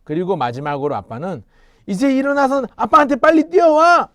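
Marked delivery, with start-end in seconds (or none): 1.9-4.1 urgent shout. Acted. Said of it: "이렇게 외쳤어요".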